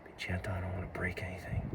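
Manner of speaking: fast breathy whisper to himself. Real, not acted. Cutting the rope off the birds, a quick whisper to himself. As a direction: hush it, quick and under the breath.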